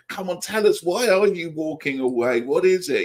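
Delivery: high, eager coaxing voice. Pretend. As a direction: plead with the figure to open up. Talking to the card figure.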